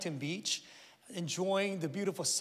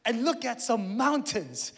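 bright, higher excited announcement; dad announcing a surprise trip, higher and eager; announcing to kids; 6 semitones higher, 5 dB louder than usual. Retold or imitated. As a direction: sell the trip to the kids with big enthusiasm. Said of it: "I'm like".

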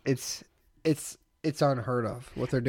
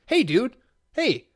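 high, loud mock yell at a stranger; a shouted hey, hey, dude, hey at a stranger's back; retold yell; 10 semitones higher, 5 dB louder, much slower than usual. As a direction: call out high and hard across the aisle. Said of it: "you yell and you go".

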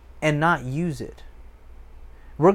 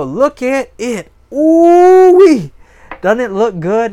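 high, loud delighted gushing; hammed-up admiring ooh-wee; gushing show-off; 8 semitones higher, 13 dB louder, slower than usual. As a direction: squeal over your shiny equipment. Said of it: "you show it and you go".

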